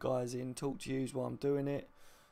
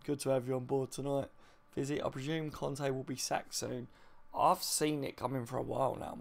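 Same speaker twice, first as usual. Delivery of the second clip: ordinary talk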